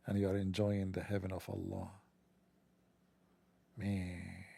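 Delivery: soft, low, savoring the fantasy; low, dreamy murmur. Pretend. Comedian inviting listeners to picture heaven, low and soft. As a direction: sink into a hushed, lingering daydream.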